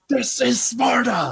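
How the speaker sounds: slow, high shouted movie line; Spartan battle cry. Retold or imitated. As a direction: bellow it like a war cry. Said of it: "the first thing you did was like".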